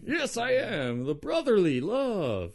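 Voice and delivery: put-on Pennsylvanian voice, slowing at the end; mock Pennsylvania accent. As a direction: insist in a silly Pennsylvanian accent. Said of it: "I don't know what Pennsylvanians' accents are".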